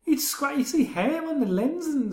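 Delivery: high-pitched, incredulous griping; exasperated complaint. Real, not acted. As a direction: complain in disbelief, pitched right up. Complaint about a bad video transfer.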